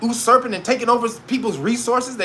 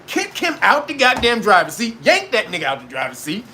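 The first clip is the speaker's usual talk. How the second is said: ordinary talk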